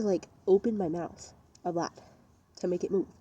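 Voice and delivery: normal talking voice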